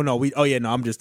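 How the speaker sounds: ordinary talk, nothing marked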